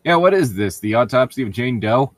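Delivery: ordinary talk, nothing marked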